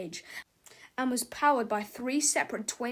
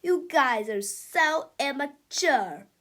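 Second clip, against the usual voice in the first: slow, high, louder scornful voice; snooty sneer; 4 semitones higher, 6 dB louder, slower than usual. Acted. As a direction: look down your nose at them. Melvin sneers at comic.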